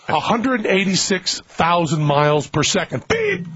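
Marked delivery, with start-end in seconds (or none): 3.1-3.5 high buzzer imitation; mock buzzer noise; buzzer beep. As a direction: squeak it like a quiz show buzzer. Imitating game show buzzer.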